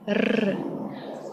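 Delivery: long trilled R on its own; rolled R. Shown as a demonstration. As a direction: hold "r" as a tongue-tip roll. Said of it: "roll this letter inside your mouth".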